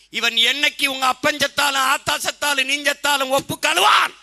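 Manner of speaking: angry shout. Real, not acted. Orator denounces the rival party to the crowd, shouting. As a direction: rail against him at full voice.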